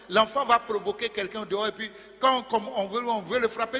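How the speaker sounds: ordinary delivery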